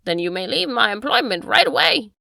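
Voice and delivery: cold dismissal. Acted. Master dismissing the butler.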